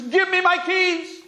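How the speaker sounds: high, slow pleading yell; a high drawn-out desperate plea; yelling to God. Retold or imitated. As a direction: beg heaven for the keys, slowly. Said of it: "He yells to HaKadosh Baruch Hu".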